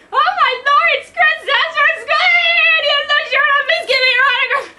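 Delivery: very high, loud fangirl squeal; excited squeal. A narrator's reading. Lady squealing at a celebrity in the gym, excited squeal. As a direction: squeal at the top of the register, starstruck. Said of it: "The lady squealed".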